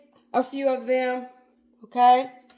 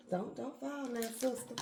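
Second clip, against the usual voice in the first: quiet coaxing; 12 dB quieter than usual. Real, not acted. Coaxing a wobbly item on plate.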